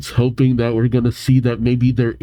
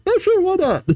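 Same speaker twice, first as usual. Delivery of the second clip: much higher, mock-casual quote; breezy agreement; faster than usual. Gym leader casually agreeing to the invite, breezy mock quote. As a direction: agree as if it's nothing. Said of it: "she's just like".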